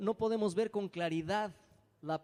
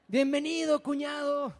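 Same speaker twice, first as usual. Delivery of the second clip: mock welcoming greeting; 9 semitones higher, 6 dB louder than usual. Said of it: "prefirió que le dijeran cuñado".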